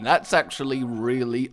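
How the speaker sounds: ordinary delivery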